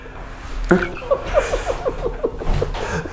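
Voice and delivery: high, breathless burst of laughter; real uncontrollable giggling from relief; helpless laughter. Real, not acted. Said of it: "you were laughing".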